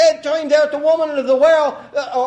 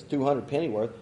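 usual speaking voice

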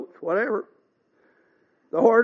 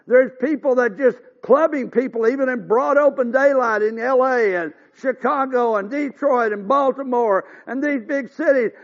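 preaching at full force; 3 semitones higher, 5 dB louder than usual. Acted. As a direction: hammer each word home to the congregation.

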